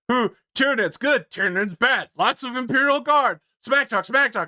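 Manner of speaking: high mocking singsong; mocking chatter. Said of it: "it's just like".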